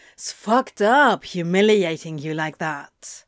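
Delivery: indignant, leaning hard on the opening; arguing. A narrator's reading. Crowley objects to his counterpart's treatment, indignant arguing. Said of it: "Crowley argued".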